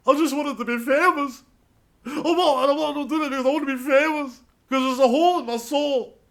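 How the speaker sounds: a shrill cartoon of a wannabe celebrity; mock fame-hungry victim. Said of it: "moral vacuum where you go".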